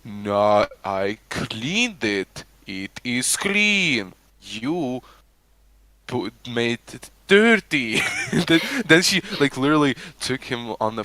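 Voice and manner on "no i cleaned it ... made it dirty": put-on slow, deliberate Russian accent. Imitating a cleaner blaming a man, slow Russian accent. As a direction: drag out the words in a mock Russian accent. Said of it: "speaking this Russian accent".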